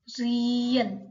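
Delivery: रियल said clearly with a falling close; teacher models रियल for learners; model pronunciation. Shown as a demonstration. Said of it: "अगला है हमारा रियल".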